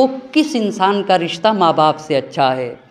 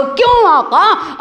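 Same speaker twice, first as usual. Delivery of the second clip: rhetorical plea; 7 semitones higher, slower than usual. Acted. Rhetorical question to imam.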